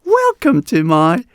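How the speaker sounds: very high, loud, over-the-top announcer welcome; hammed-up erratic event host greeting; erratic hyped host. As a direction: ham it up like a frantic showman. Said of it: "speak like this".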